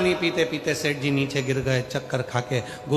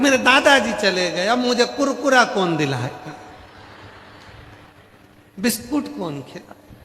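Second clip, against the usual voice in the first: higher, louder child's whimper, lightly voiced; crying little grandson; 7 semitones higher, 5 dB louder than usual. Little grandson crying over grandfather, lightly voiced child. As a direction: play a small boy sobbing over his lost treats. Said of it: "छोटा सा पोता रो रहा था".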